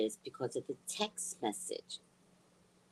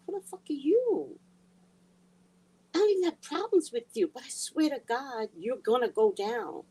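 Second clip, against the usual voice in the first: retold fury; 9 semitones higher, 4 dB louder than usual. Reenacting fight with daughter-in-law.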